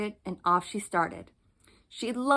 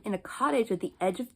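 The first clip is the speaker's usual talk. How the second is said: plain reading voice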